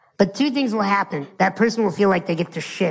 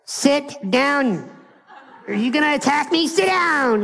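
mock alarm; 7 semitones higher, slower than usual. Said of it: "you'd be like".

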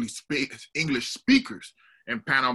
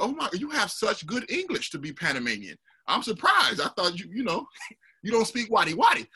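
high-pitched imitation of amazed parents; imitated astonished compliment; mock surprise; 8 semitones higher than usual. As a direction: marvel politely, voice lifted high. Voices surprised parents praising her English.

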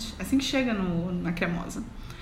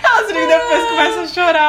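fast, higher and louder through laughter; laughing; 7 semitones higher, 7 dB louder, faster than usual. Real, not acted. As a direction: tell it while cracking up. Laughing retelling the episode.

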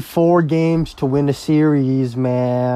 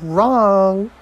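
put-on a lightly raised, higher girl's voice telling her story; imitating a girl; 6 semitones higher than usual. Retelling what a drunk girl told him, mildly higher mock voice. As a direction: mimic her higher pitched and breezy. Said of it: "she was like".

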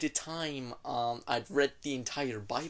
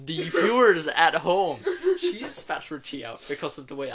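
laughing to audience; 8 dB louder than usual. Real, not acted. Host laughs about spat tea.

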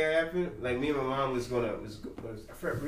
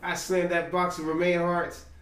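ordinary talk, nothing marked

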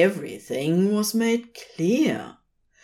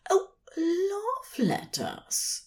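slow, higher, lost in a lovely thought; said dreamily; 13 semitones higher, 5 dB quieter, slower than usual. A narrator's reading. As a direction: sigh it out like a daydream. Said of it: "Aziraphale said dreamily".

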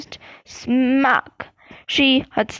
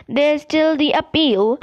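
a touch of fierceness, lightly raised; fierce; 3 semitones higher than usual. A narrator's reading. Ron refusing to give up on Buckbeak, mildly fierce. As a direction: insist with mild read-aloud heat. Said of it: "said Ron fiercely".

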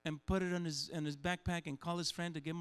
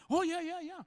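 high, louder eager yeahs; mimicking eager crowd; 12 semitones higher, 4 dB louder than usual. Pastor mimics crowd recalling manna, higher and louder. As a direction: jump in with a keen yes.

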